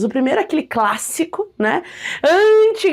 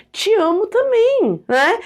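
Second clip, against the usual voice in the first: casual affectionate; 8 semitones higher, slower than usual. Acted. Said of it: "Vou falar o quê?".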